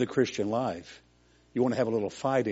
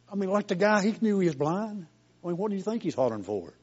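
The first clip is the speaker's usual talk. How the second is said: much higher, amazed disbelief; incredulous; 9 semitones higher than usual. Real, not acted. Incredulous aside about blind man.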